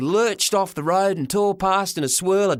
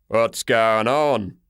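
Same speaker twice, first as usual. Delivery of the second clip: very slow, low, big man; a slow rumble from a huge man; lightly voiced giant, slow and low; giant's voice; 5 semitones lower, much slower than usual. A narrator's reading. The giant garage owner speaks.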